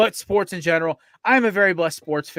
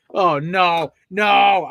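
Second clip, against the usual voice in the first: louder panicked "no, no" rising to a shout; retold panic; 7 dB louder, much slower than usual. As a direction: relive the moment of panic. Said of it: "So I'm freaking out".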